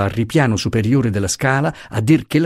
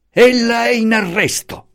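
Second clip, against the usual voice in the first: high, strained shout of arrest; 13 semitones higher than usual. A narrator's reading. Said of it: "gridò l'aggiunto".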